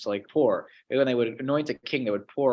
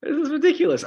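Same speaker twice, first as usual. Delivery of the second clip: high, laughing scoff; amused high scoff through a laugh; God laughing at them; 11 semitones higher than usual. Retold or imitated. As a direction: laugh off their silly plot. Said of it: "he's like".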